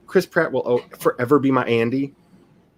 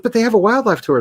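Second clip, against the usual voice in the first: mother-in-law; 9 semitones higher, 3 dB louder, faster than usual. Retold or imitated. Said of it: "She's like".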